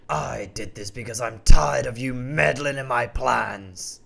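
slow, low gloating lion villain; Mister Kibbles gloats over his revenge. Acted. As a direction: lay out the crime slowly, savouring it.

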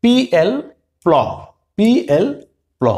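পিএল spelled out, then প্ল blended; drilling the letter names into the pl sound. Shown as a demonstration. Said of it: "পিএল sound হবে".

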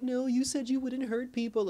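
pleading protest. Retold or imitated. Lucy protests to the villain.

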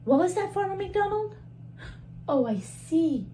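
ordinary delivery

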